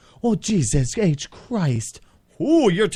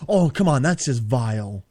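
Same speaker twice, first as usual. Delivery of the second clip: usual speaking voice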